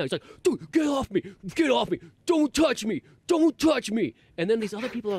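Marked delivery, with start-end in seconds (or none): 0.4-4.1 high-pitched mock freak-out; agitated shouted protests, pitch way up. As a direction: imitate a hippie yelling to be left alone. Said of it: "this hippie guy was freaking out".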